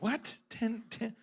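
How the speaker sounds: baffled one-word questions, one jumping up high; stunned double-take in short bursts; mock bewilderment. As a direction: reenact being thrown by the news. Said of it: "I heard that the first time. I went".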